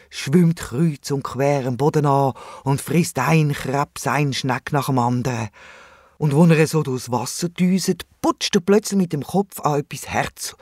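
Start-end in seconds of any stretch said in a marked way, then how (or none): none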